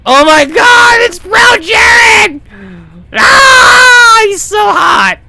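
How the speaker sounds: high squealing fangirl shout; excited anime fan. Acted. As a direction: scream it like a swooning fan. Fangirling over the character.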